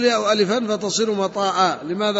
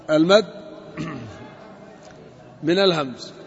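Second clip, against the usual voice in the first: ordinary delivery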